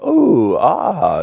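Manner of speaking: high, drawn-out mock oohs and aahs; imitating cooing crowd. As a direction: coo like an audience charmed by a baby animal. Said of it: "they'd be going".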